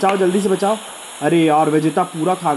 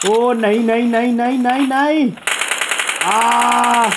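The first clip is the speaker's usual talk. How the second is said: rising, higher and higher pleading nahin; anxious excited no; 5 semitones higher, slower than usual. Real, not acted. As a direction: cry out nahin as the player walks into danger. Caster dreading a knock.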